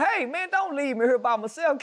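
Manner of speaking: hammed-up high-pitched begging; mock pleading. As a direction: beg not to be abandoned, comically high. Acts out the plea he held back.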